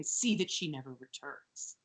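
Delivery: low, hushed ominous command; dark, quiet order. Acted. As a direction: drop it down soft and menacing. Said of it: "ominous thing".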